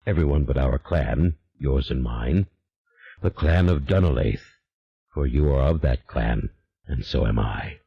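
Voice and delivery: low, unhurried otherworldly demon, calm and sure; a patient spirit promising a way back. A narrator's reading. The demon instructs Julien in a low, calm voice.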